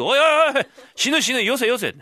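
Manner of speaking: high loud protest; loud alarmed protest; alarmed shout. Acted. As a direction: stop him, alarmed. Father stops baby being pressed.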